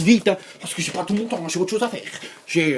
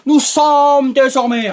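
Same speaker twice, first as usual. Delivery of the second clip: hammed-up presenter; 6 semitones higher, 5 dB louder than usual. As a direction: overblown opening announcement with stretched, high words. Exaggerated comic presenter accent.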